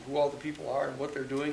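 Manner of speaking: ordinary delivery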